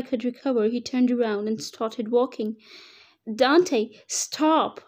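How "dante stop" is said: louder, higher cry of stop; urgent call. Acted. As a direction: call him sharply before he goes too far. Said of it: "I called him".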